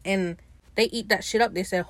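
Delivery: normal talking voice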